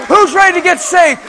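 loud, high altar call; rally the crowd to be saved; rousing call. Retold or imitated. Calling the porch to salvation.

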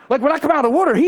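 high and loud, bursting with joy; joyful build. Retold or imitated. Preacher recalls coming out of the water, joyful and loud. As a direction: lift it up with rising joy.

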